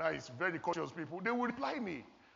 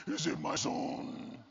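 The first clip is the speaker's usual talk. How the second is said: slow breathy whisper of a divine voice; hushed theatrical divine whisper; mock voice of God; 3 dB quieter, much slower than usual. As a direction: intone it slowly like a movie God. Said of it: "I heard the voice".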